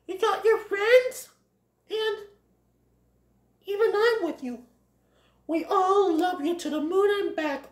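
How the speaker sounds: high-pitched, heartfelt character voice; earnest Feline. Acted. Feline assures Bambi he is loved, high earnest voice.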